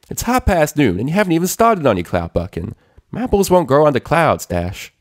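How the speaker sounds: high, loud country scolding, quick toward the end; scolding farm pony. A narrator's reading. Applejack scolding Rainbow for oversleeping, loud and high. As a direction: scold her like a bossy friend.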